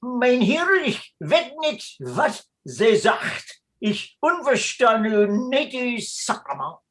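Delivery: switching to mock Dutch-Flemish, slow; fake Flemish. Priest pretending to speak only Flemish to escape arrest, mock Flemish. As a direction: pretend not to understand, in broken Flemish. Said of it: "Altering your language won't do".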